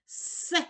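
a long hissed С; С без голосного, протяжно; isolated sound. Shown as a demonstration. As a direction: hiss the С alone, no vowel. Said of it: "Який ти чуєш перший звук?".

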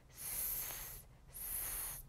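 "스" as a long voiceless hiss, no vowel; S letter sound. Shown as a demonstration. Teacher showing how S sounds, "스" as a pure hiss. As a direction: sustain a soft sss for "스". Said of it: "S는 어떤 발음이 나냐면요".

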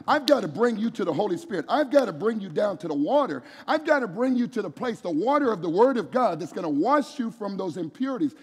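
high, loud preaching of God's words; full-force preaching. Acted.

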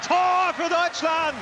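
slow, drawn-out goal shout; screaming goal call. Real, not acted. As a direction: belt out the goal. Goal call in live commentary.

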